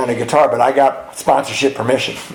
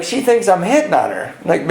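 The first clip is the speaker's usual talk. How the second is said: normal talking voice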